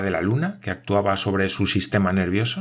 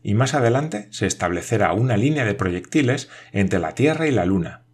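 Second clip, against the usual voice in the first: ordinary delivery, nothing marked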